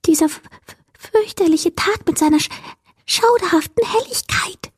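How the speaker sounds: high, stammering little creature; frightened creature whisper. A narrator's reading. Creature dreading the daylight, high fearful stammer. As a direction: shudder through it, tripping on first sounds. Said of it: "flüsterte das Wesen".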